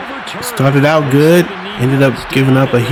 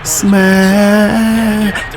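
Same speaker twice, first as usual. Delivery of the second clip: higher, announcer-style smash call; smash sounder; 7 semitones higher than usual. Acted. Gamer calling the sack like a hype sound effect. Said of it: "that smash sounder".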